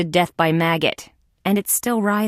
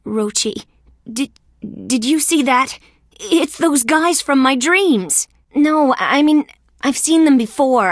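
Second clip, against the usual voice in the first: higher, agitated and stammering; startled, pitch jumping up; alarmed disbelief; 4 semitones higher than usual. Acted. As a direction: blurt it out, rattled by the sight. Spots creatures from his nightmares.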